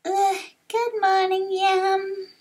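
high, drawn-out morning greeting; groan, then greet in a high little voice; waking young hero. Acted.

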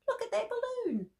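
put-on very high little girl's voice. A narrator's reading. Little girl shows her mother the balloon, very high voice. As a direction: point and squeal it up high like a child. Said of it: "said a little girl".

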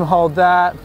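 ordinary talk, nothing marked

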